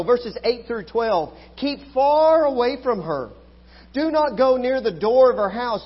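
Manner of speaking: loud, high, full-force preaching voice; fiery preaching. Acted. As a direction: preach it hard to the congregation.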